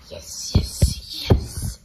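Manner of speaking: unvoiced, forceful whisper; excited stage whisper; whispered yes. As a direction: whisper it in sharp repeated bursts.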